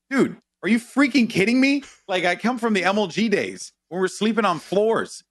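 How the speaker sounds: high, emphatic retold disbelief; incredulous protest. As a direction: protest as if it's absurd. Retells incredulous reply to gig organiser.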